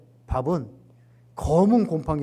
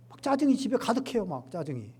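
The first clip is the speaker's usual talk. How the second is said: ordinary delivery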